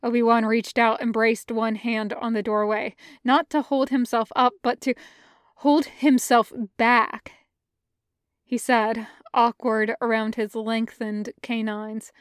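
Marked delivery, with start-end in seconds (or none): none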